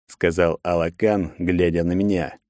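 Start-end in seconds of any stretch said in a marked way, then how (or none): none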